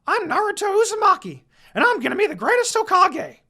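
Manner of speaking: put-on high, loud, fast boastful boy's shout; Naruto. A narrator's reading. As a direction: crow it out like a cocky kid hero. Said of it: "finally snapped out of it and said".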